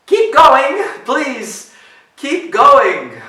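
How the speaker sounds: high, anxious, loud plea; pleading little ogre. A narrator's reading. Scared ogre hoping goat moves on, high and pleading. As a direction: beg in a small high voice, not truly whispered. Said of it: "Little ogre whispered".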